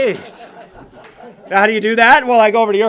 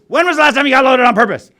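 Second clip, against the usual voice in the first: louder, higher pointed question; pointed demand; 8 semitones higher, 9 dB louder than usual. Retold or imitated. Old-timer grilling the newcomer, louder. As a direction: press him with the question. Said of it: "he goes".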